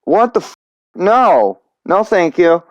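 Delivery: jumps up high, shocked, then a quick no thanks; high, startled what the f- and a flustered no; startled refusal. Retold or imitated. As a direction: blurt it out in alarm, then catch yourself politely. Startled by a creepy stranger.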